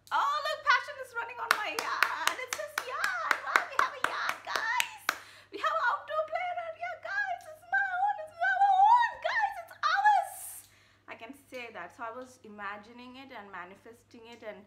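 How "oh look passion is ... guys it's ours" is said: much higher, loud, squeaky giddy squeal; squealing with delight. Pretend. Showing viewers her own yard, giddy and squealing. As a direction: squeal like a kid who just got the keys. Imagined gleeful home tour.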